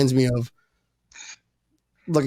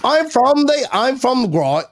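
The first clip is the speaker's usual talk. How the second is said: put-on higher, half-hearted British accent; British accent attempt; 8 semitones higher than usual. Host retrying his British accent after teasing, half-hearted. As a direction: try the British accent again and give up. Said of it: "do impressions of people from England".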